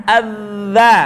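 "Eh, za" with a long voiced dh; held-air consonant. Shown as a demonstration. Teacher repeating the drill, sustained buzzed dh in "za". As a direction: buzz into "za" without releasing air. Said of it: "udaranya ditahan".

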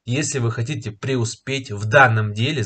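ordinary delivery

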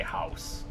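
slow Canadian "house", lightly shown. Shown as a demonstration. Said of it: "We have a funny way of saying house".

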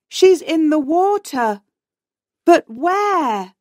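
high, urgent alarm; alarmed exclamation. A narrator's reading. Friend missing in stormy sea.

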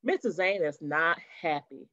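plain reading voice

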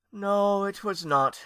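high, mournful wail of agreement; sad agreement. A narrator's reading. Aunt sadly agreeing with her nephew, mournful. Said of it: "Lady Esther agreed sadly".

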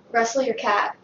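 usual speaking voice

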